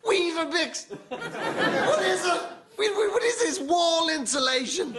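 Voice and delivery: high, baffled sneer at the cereal; mock disgust. Comedian sneering at British breakfast cereal, high and incredulous. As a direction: ham up the outrage at bland cereal.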